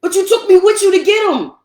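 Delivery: loud, high-pitched complaint; heated accusation. Real, not acted. Accusing partner over the balloon errand, heated accusation. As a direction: throw it back at them, loud and high.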